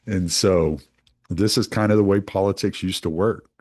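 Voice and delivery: ordinary talk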